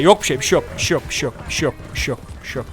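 fading from soft to a whisper; hushing fade. Acted. Soothing the startled stranger, fading into a whisper.

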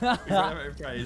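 rally the team with it. Real, not acted. Repeated rallying line.

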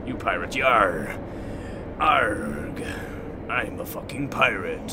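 growly pirate talk; hammed-up pirate voice. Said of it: "I like to do the power pirate voice".

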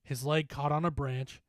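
ordinary delivery

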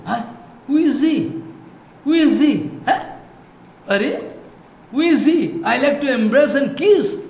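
high, loud wondering voice of Krishna; amazed Krishna. A narrator's reading. Krishna staring at his reflection in a pillar, in amazed wonder. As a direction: gasp at his own reflection, then long to hug him.

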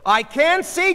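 high, loud, straining; frantic cry. A narrator's reading. Goldie unable to see the stretcher, frantic cry. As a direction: call back urgently.

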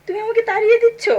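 high, loud wounded outcry; hurt protest. Acted. Daughter hurt at being sent away.

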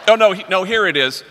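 loud high flustered fumbling; mock flustered. Imitating the embarrassed introducer, loud fumbling. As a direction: fumble loudly for the right line. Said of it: "And she goes".

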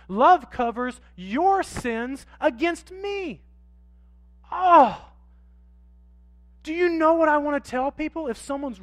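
loud, high preaching swell; full-force preaching. Acted. Pastor to congregation, loud impassioned preaching. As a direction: preach it out with rising fire.

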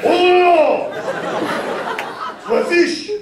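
high, loud mock alarm; mock panic. Imagined rival chef finding no seafood, mock-shocked. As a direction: squeal it like a chef who lost his fish. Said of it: "'Cause he'd be like".

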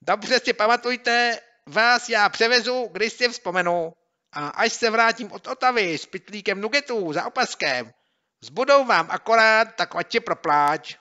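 gloating taunt. Acted. Bully gloating over the tricked boys, gleeful. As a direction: gloat at them.

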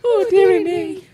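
laughing. Real, not acted. Laughing fit.

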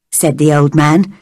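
ordinary delivery

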